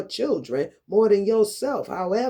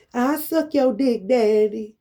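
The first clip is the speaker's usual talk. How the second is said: higher, taunting put-on voice; mock borrowed voice; 4 semitones higher than usual. Mocking the woman whose voice is borrowed, taunting imitation. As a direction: mimic the rival in a raised voice. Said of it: "that ain't even your voice".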